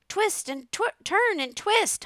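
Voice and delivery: very high, drawn-out honk; honking goose. A narrator's reading. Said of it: "honked the goose".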